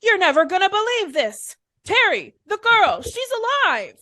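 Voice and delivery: high, loud breaking-news cry; excited shout. Acted. Captain bursting in with news of the girl, shouting excitedly. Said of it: "And he shouts".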